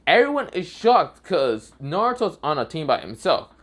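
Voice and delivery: plain reading voice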